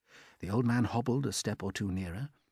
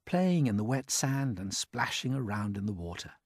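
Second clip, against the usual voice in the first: usual speaking voice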